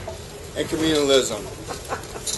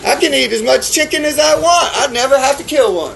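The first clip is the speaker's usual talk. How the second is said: higher, laughing mock of a smug chicken eater; 6 semitones higher than usual. Chicken eaters at a New Hampshire conference, laughing mock quote. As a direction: toss it off like someone who never has to kill.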